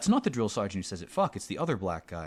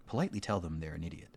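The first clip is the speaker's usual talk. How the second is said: ordinary talk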